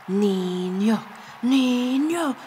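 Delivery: ordinary delivery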